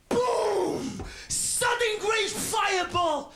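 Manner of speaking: loud, high burst for the explosion; dramatic shout of the explosion; explosive boom. Retold or imitated. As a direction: blast it out like a fireball going up. Gas explosion in story.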